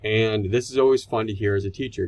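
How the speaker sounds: ordinary delivery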